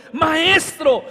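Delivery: loud, high cry of Maestro in panic; shouted cry. Retold or imitated. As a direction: yell it like frightened disciples in a storm. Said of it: "le gritaron".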